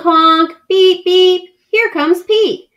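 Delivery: ordinary delivery, nothing marked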